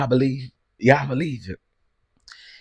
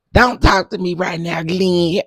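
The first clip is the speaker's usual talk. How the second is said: mock snap; 6 semitones higher, 5 dB louder than usual. Wife snapping at Glenn, retold.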